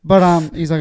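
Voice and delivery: normal talking voice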